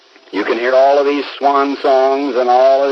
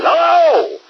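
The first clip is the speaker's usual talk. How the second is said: furious yell. Retold or imitated. Stranger snatching up the phone a third time, furious yell. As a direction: shout it, at the end of his rope.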